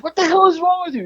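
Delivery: fast, very high-pitched incredulous question; appalled demand. Retold or imitated. Employee questioning the man he dragged inside, appalled. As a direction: rattle it out high, re-enacting the outrage. Acting out confrontation in the office.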